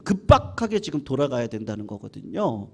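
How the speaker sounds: ordinary talk, nothing marked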